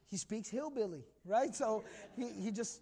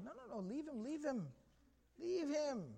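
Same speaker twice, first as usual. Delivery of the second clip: soft, higher, acted-out hushing; soothing restraint; 4 semitones higher, 6 dB quieter than usual. Prophet holds Umar back from the man, soothing. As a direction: gently wave off an angry friend, voice softened.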